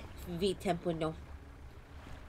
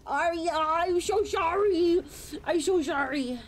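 higher, louder dramatic sorry; over-the-top apology to Aria; 8 semitones higher, 5 dB louder than usual. Real, not acted. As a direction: wail it apologetically. Apologizing loudly to chatter.

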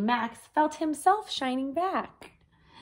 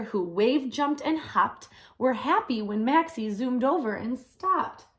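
plain reading voice

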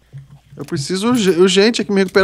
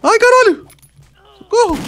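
panicked; 12 semitones higher, 4 dB louder than usual. Real, not acted. Attacked by zombies.

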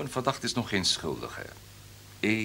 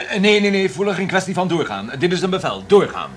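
loud, high, commanding; stern order; 9 semitones higher, 9 dB louder than usual. Acted. Director loudly ordering Voeler to keep going. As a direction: bark the order down the phone. Boss ordering agent to continue.

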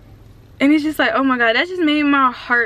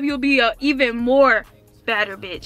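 ordinary delivery

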